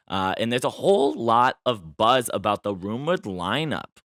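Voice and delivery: ordinary delivery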